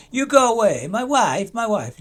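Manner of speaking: high, loud mock Borat-style accent; lightly hammed-up foreign accent shooing someone. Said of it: "It sounded like Borat, didn't it?".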